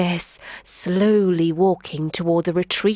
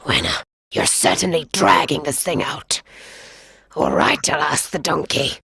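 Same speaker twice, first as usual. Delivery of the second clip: low, impatient big cat; lion indulging the boy; grumbling lion; 5 semitones lower than usual. Acted. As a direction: sigh it out, fed up.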